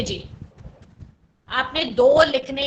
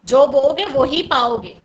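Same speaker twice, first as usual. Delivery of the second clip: emphatic proverb; 8 dB louder, faster than usual. Acted. Teacher stresses Hindi proverb.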